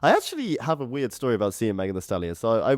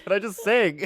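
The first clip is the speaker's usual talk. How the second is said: higher, mocking singer whine; 10 semitones higher than usual. Mocking the singer to her co-host, pitched up. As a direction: play the singer begging to just sing.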